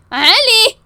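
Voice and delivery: loud, high call of his name; sharp call. Acted. Demanding an answer from Ali, sharp call. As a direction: call him out, pitch jumping up.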